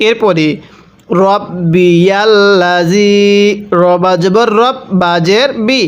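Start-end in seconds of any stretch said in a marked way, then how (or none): 1.2-3.1 slow, stretched recitation with long vowels; drawn-out Quran recitation. Shown as a demonstration. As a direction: chant it slowly, holding the long vowel. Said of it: "কয় আলিফ টান দিতে হবে".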